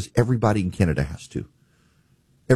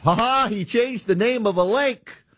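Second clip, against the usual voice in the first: high-pitched mock guffaw; sing-song fake "ha ha", pitched way up; mocking laughing crowd; 13 semitones higher than usual. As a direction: imitate golf buddies hooting at a silly joke. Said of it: "some crowd of yours at the golf course that laugh".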